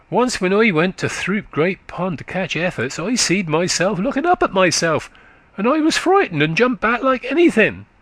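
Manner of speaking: put-on high, quick childish voice of a country boy; little boy chatting up to a tired woman, high and eager; chattering little boy. A narrator's reading. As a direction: prattle like a small eager lad. Boy tells his fright.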